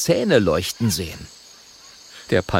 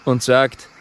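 ordinary delivery

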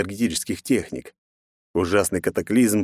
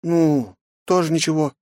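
shrugging; 8 semitones higher than usual. Acted. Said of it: "пожав плечами, ответила хранительница".

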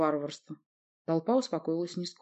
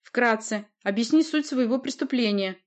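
firm, higher-pitched order; commanding; 6 semitones higher, 4 dB louder than usual. A narrator's reading. Sorceress orders the witness to confess, commanding. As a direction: order an account from the prisoner. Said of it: "приказала Власта".

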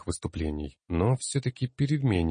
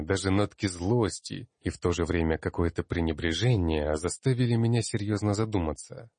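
usual speaking voice

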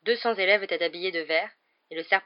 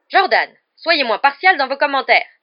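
loud, raised-pitch reprimand; scolding; 8 semitones higher, 14 dB louder than usual. A narrator's reading. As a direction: call the commentator to order. Professor scolds the biased commentator. (réprimande sonore de professeur)

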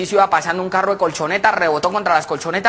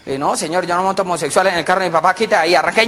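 normal talking voice

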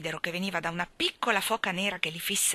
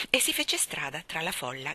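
plain reading voice

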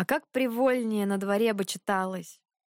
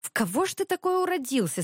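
raised pitch, puzzled and interested; curious wonder; 9 semitones higher, faster than usual. A narrator's reading. Dragomir wondering at his odd brother, curious. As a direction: ask with lively curiosity. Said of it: "с интересом глянул на него".

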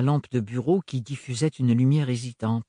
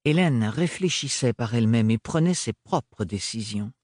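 ordinary delivery

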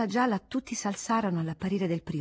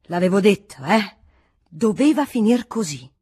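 louder, slower told-you-so outburst; loud exclamation; 8 dB louder than usual. Acted. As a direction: barge in and proclaim it loudly, slowing at the end. (il monaco esclama ad alta voce, con tono saccente)

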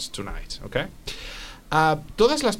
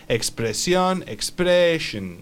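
expression drawn out slowly, ex-PRE-shun; teacher's slowed-down expression; slow model pronunciation; 3 semitones higher, much slower than usual. Shown as a demonstration. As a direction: stretch the stressed middle of expression for a learner.

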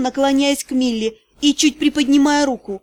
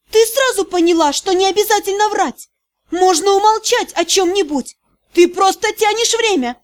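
sudden shout; 6 semitones higher than usual. A narrator's reading. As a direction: snap into a shout, accusing her. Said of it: "резко сорвавшийся на крик".

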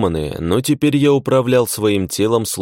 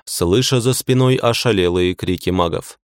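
plain reading voice

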